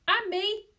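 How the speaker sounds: high, loud eager shout. A narrator's reading. Boy shouting as he piles in, high and loud. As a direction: call out gleefully. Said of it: "Joe shouted".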